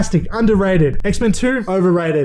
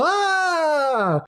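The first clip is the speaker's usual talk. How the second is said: high, drawn-out fake scream; hammed-up horror-trailer "ah"; mock scream; 11 semitones higher, 4 dB quieter than usual. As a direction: let out a long, high pretend shriek. Mock scream at the devil reveal.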